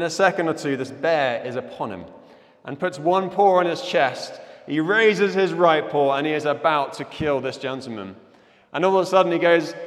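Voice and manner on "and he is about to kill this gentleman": normal talking voice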